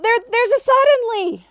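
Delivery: high, loud excited call. A narrator's reading. Child blurting out a suddenly to the class, calling out. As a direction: blurt it out like a thrilled kid. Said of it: "Malcolm called out".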